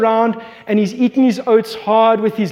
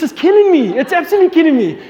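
higher, louder, rushing frustration; exasperated; 6 semitones higher, 3 dB louder, faster than usual. Real, not acted. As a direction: let it burst out, fast. Frustrated dad watching son cry.

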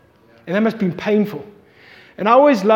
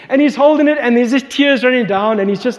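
normal talking voice

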